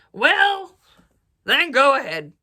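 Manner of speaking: loud, grudging troll bellow. A narrator's reading. Troll letting the goat pass, loud bellow. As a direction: bark permission as the troll. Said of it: "said the troll".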